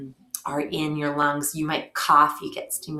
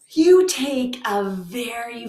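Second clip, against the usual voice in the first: yawning; 5 semitones higher, slower than usual. Real, not acted. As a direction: yawn stretching the words. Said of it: "look, he did it too".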